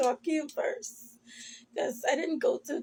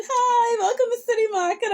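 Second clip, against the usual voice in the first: high, loud, fast greeting with a sob in it; chirpy store greeter; 9 semitones higher, 8 dB louder than usual. Retold or imitated. Cashier mimicking her greeter job to viewers, loud and high with a cry. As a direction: belt out the door greeting through tears.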